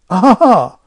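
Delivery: loud, high forced vicar's laugh; uneasy laugh. A narrator's reading. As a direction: fake a nervous ha-ha. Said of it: "laughed the vicar uneasily".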